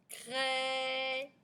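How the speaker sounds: CRAIE with the è held long; elongated vowel. Shown as a demonstration. Teacher repeats for the answer, "craie" drawn out. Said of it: "c'est le son est".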